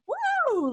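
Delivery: high excited woo; roller coaster whoop. Retold or imitated. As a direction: whoop like on a ride. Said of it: "Wouldn't you be like".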